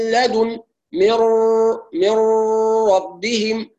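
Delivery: مر with a long nasal ghunna from the nose. Shown as a demonstration. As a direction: hold the ghunna through the nose. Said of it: "هكذا تكون الغنة من الخيشوم". (مر بالغنة من الخيشوم)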